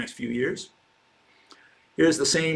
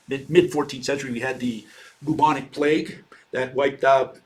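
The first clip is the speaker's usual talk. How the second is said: ordinary delivery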